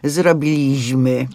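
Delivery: slow, drawn-out "Zrobiliśmy" as a bad example; wrong stress demo. Shown as a demonstration. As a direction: show off the faulty stress on "Zrobiliśmy", zro-bi-LIŚ-my. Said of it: "nie ma nic gorszego niż właśnie to powiedzenie".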